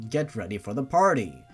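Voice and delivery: ordinary delivery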